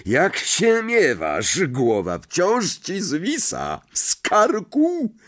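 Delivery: slow, high-pitched mocking heartiness; boisterous ghost. A narrator's reading. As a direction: tease him with drawn-out booming joviality. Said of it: "Parsknął rubasznym śmiechem".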